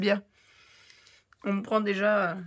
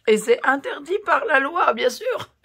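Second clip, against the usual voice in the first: laughing, higher and louder; 6 semitones higher, 5 dB louder than usual. Real, not acted. Youtubeuse laughs at the hater's torture complaint. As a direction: laugh out the words in disbelief. Mocking the hater's legal threat.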